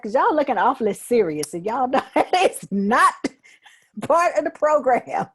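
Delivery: loud, high, laughing through the words; laughing tease. Real, not acted. Host teasing meditation group for looking serious, laughing. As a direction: tease them with a real laugh breaking in.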